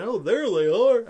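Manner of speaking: bad Seinfeld-like accent, loud and swooping; exaggerated, botched Jerry Seinfeld-style accent; stupid little accent. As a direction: badly fake a silly accent, drawn out high and loud. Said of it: "with a stupid little accent saying".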